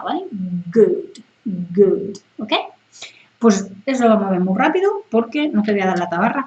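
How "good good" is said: good, g against the palate; good, tongue back up for the g. Shown as a demonstration. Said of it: "sí que toca el paladar con la parte de arriba". (good con g que toca el paladar)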